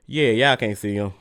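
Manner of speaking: usual speaking voice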